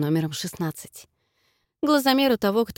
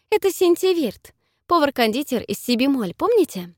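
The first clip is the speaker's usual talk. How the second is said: nervous; 7 semitones higher than usual. A narrator's reading. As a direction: rush the introduction nervously. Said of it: "отчаянно труся".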